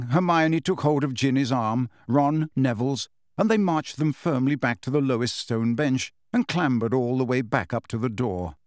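plain reading voice

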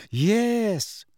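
high, drawn-out sneering yes; scornful. A narrator's reading. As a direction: say it with a curled lip. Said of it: "her lip curling".

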